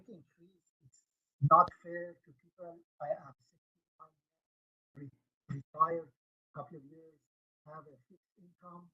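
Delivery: fading away from the mic. Real, not acted. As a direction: voice drops out on a bad line. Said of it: "you fade out".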